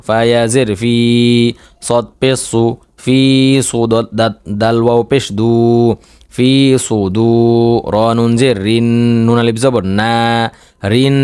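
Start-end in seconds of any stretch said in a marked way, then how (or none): none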